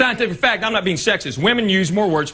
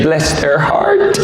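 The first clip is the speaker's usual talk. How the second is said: sweet Southern lady; 2 semitones lower, 5 dB louder, much slower than usual. Retold or imitated. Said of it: "as long as you say".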